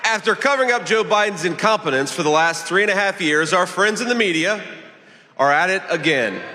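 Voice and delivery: high, projected stump-speech delivery; rally speech. Real, not acted. Candidate attacks the opposing party before a crowd, rally speech. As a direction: address the crowd in measured, emphatic phrases.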